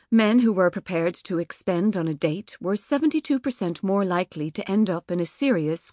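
plain reading voice

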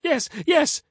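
very high, eager cry; cried out. A narrator's reading. Caesar hearing the beggar's omen, high eager cry. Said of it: "cried Caesar".

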